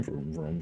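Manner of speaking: whispered engine vroom; silly clown. Pretend. Repeated engine noise, whispered take.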